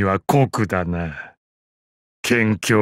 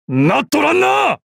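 put-on old master barks a rebuke, voice thrown high; scolding battle shout. Battle taunt at an opponent.

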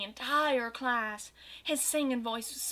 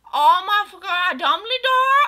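very high, loud, slow disbelieving shriek; shocked; 8 semitones higher, 12 dB louder, slower than usual. Acted. Goth girl hearing Dumbledore called, shocked shriek. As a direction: wail the name in horror.

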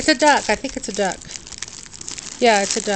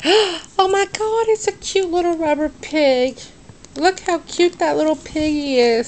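delighted gasp; 6 semitones higher than usual. Real, not acted. Gasps at a cute toy.

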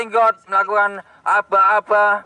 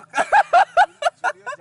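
high laugh. Real, not acted. Laughing at joke.

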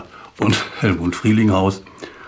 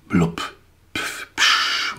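whispered sea noises; 4 semitones lower, much slower than usual. Retold or imitated. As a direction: breathe out the Baltic's splash sounds. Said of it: "wie Grass seinen Übersetzern erklärt". (geflüsterte Meeresgeräusche)

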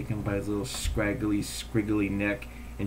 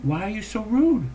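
exasperated thought; 8 semitones higher than usual. Retold or imitated. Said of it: "I thought".

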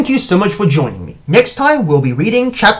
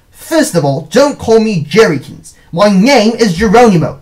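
building louder, higher protest; indignant cry; 4 semitones higher, 6 dB louder than usual. A narrator's reading. Brother objects to being called Jerrykins, crying out. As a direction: protest the nickname, ending loud. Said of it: "I cried".

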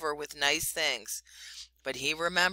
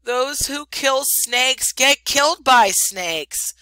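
much higher and louder, slow mournful warning; sorrowful; 8 semitones higher, 10 dB louder, slower than usual. A narrator's reading. Chuchundra warns Rikki Tikki of snakes, more sorrowfully than ever. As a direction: sigh it out in gloomy despair. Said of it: "more sorrowfully than ever".

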